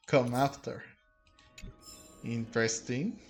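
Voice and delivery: normal talking voice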